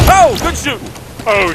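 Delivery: high startled cry; surprised outcry. Real, not acted. Player sniped unexpectedly, startled high outcry. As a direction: caught off guard, cry out.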